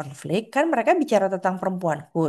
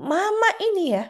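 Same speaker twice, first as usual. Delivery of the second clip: much higher, sing-song scolding mom; a mother justifying her scolding, pitched way up; mock scolding mom, high voice; 9 semitones higher than usual. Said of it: "kan kita bilang".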